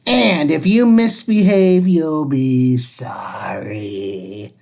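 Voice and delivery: a menacing witch warning; witchy threat. Acted. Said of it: "said Miss Swamp".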